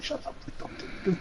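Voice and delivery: hushed, breathy plea to himself; a tense whisper mid poker hand; whispered inner plea. Retold or imitated. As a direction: whisper it, willing the other player to stop. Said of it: "I was also just like".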